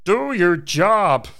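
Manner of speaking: slow lead-in phrase for an impression; drawn-out, swooping mock lecturer; Jordan Peterson. Shown as a demonstration. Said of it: "leading phrase to try and do Jordan Peterson".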